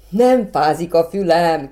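put-on boy shouting happily while shovelling snow; joyful shout. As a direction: shout with pure joy. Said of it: "kiáltott örvendezve Fülöp".

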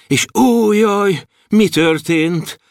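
high cry of dismay, slowing; alarmed lament. A narrator's reading. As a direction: draw out the dismay from a high start. Narrator laments the mishap. (magasról induló, elnyújtott jajgatás)

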